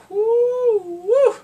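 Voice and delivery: loud, high "whoo"; high-pitched cheer of amazement; excited whoop. Real, not acted. Vlogger's own excited reaction.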